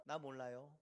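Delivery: low, soft shrug-off; offhand dismissal. Acted. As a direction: brush it off with feigned ignorance. Acting out believers who forget God. (시치미 떼는 말투)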